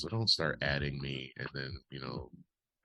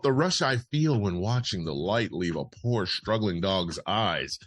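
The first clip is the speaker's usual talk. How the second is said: brisk newsreader delivery of a quote; news read; 5 semitones higher, 6 dB louder, slower than usual. Acted. Host reads Corden's quote as news, news read. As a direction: read it out evenly like copy. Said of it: "I should be reading this differently".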